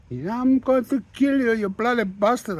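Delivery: shopkeeper screaming across the shop; screaming threat. Retold or imitated. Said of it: "swearing and screaming at each other".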